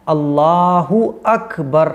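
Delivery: अल्लाहु अकबर very slow, with a full u on अल्लाहु; slow teaching demo of अल्लाहु अकबर; correct pronunciation. Shown as a demonstration. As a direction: say अल्लाहु अकबर slowly and clearly, u joined to अकबर. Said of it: "तो ये सही pronunciation है".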